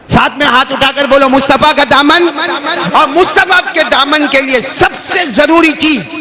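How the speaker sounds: high, sustained sermon delivery; full-force preaching. Acted. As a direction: declaim it high to a congregation. (بلند سُر میں پرجوش خطابت)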